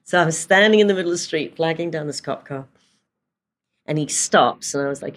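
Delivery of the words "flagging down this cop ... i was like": normal talking voice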